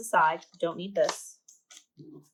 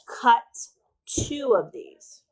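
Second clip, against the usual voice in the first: slow read-out; 4 semitones higher, 5 dB louder, much slower than usual. Shown as a demonstration. Reading label instruction aloud.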